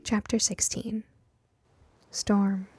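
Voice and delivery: normal talking voice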